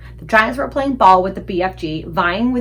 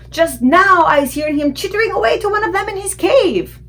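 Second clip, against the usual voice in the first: much higher, louder giant tattling; high sneering giant; 11 semitones higher, 7 dB louder than usual. A narrator's reading. Blood Bottler tattling on the BFG, high loud giant voice. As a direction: tell on him with glee.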